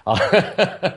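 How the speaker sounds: a sudden high laugh. Real, not acted. Laughs at guest rolling eyes.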